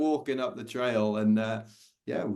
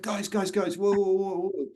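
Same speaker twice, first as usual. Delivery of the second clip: high, raised call to get attention; 10 semitones higher, 3 dB louder than usual. Retold or imitated. Warning shooters that a runner is coming, a raised call. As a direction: wave them down from across the clearing.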